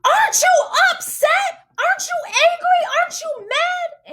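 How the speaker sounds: very high, strained angry outburst; re-enacted scream. Wife confronting husband in car, re-enacted scream. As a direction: scream each question at him, re-enacting a fight. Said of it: "I just screamed".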